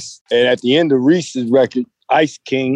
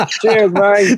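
raised, cheery fake English accent; mock English accent; 7 semitones higher than usual. Guest parroting the host in a mock English accent. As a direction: toss off a jokey English cheers. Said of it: "Any English accents we can get going".

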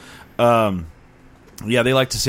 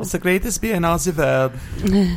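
higher hammed-up German accent; a German accent put on to mock beer pride; mock German boast; 8 semitones higher than usual.

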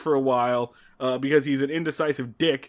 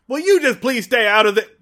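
exasperated; 7 semitones higher, 7 dB louder than usual. Retold or imitated. Andy snapping at the doctor.

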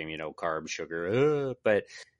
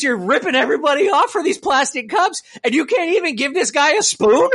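higher, heated indignation; indignant rant; 6 semitones higher than usual. Retold or imitated. To the clerk over the spoon, heated indignant rant. Retold outburst at the counter clerk.

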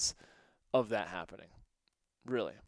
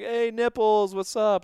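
calling out; 8 semitones higher, 9 dB louder than usual. Retold or imitated. Calling to the dog.

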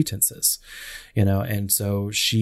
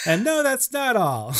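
high, slow, hammed-up indignation; mock hostile. Quoting the heroine's hostile outburst at the inquiry, mock hostile. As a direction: imitate an outraged movie heroine, drawn out. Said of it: "she was really hostile".

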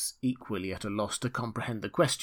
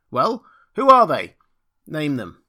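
loud, high-pitched curt questioning; austere demand; 4 semitones higher, 10 dB louder than usual. A narrator's reading. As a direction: demand it like a self-important official. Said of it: "assumed a tenfold austerity of brow".

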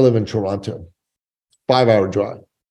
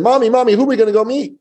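put-on high, eager child's voice; little boy; 11 semitones higher, much faster than usual. Son asking mother whom they'll meet, high childlike voice. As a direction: imitate an excited kid calling from the back seat. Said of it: "my little son sat in the back seat and said".

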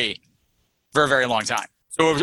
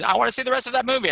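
high, fast eager plea; 9 semitones higher, much faster than usual. Retold or imitated. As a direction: blurt it out eager. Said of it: "They're like".

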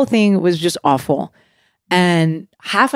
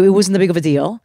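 ordinary talk, nothing marked

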